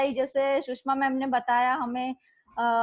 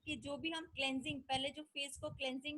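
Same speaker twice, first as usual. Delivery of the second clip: barely audible; 14 dB quieter than usual. Real, not acted. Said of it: "आपकी आवाज कम आ रही है".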